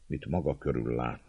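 ordinary delivery

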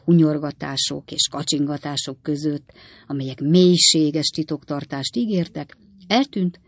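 ordinary delivery, nothing marked

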